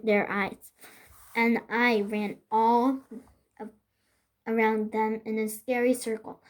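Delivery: ordinary delivery, nothing marked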